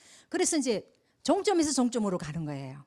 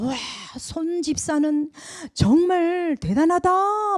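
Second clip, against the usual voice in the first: admiring praise; 7 semitones higher, 6 dB louder, much slower than usual. Retold or imitated. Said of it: "막 이러는 거예요".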